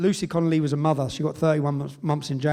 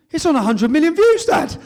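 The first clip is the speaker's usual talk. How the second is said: put-on high and loud thrilled kid; excited son; 11 semitones higher, 10 dB louder than usual. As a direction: mimic a child proudly telling dad. Son cheering up his dad.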